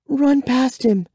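put-on high, urgent girl's voice; high-pitched hurried prompt; urgent whisper, voiced. A narrator's reading. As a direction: voice Kara's secret plan high and quick. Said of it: "Kara whispered in my ear".

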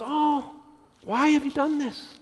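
high, delighted wife, a touch surprised. Retold or imitated. Wife opening the door to flowers, delighted and surprised. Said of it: "He goes".

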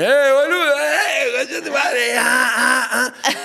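rowdy Argentine on a plane, yelling; rowdy shouting Argentine. Retold or imitated. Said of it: "te subís en avión con más de argentino".

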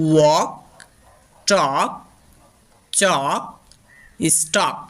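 ওয়াক, টক, চক said with an aw vowel; example words said carefully. Shown as a demonstration. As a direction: model each word slowly. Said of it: "যেমন". (অ ধ্বনিতে স্পষ্ট উচ্চারণ)

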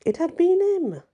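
sudden high gasp of realisation; shocked realisation. A narrator's reading. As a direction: it all clicks, shocked.

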